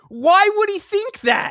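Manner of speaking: high, loud, drawn-out disbelief; incredulous. Real, not acted. Host baffled by the hero's worry, incredulous outburst. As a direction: exclaim it in exasperated bafflement. Exasperated at the comic's plot.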